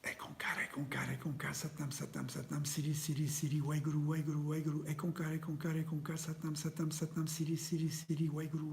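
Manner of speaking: whispered mantra pushed out on one long exhale; teacher demonstrating the mantra as a strong whisper; forceful whisper. Shown as a demonstration. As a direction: whisper it powerfully until you run out of breath. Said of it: "forcefully whispering".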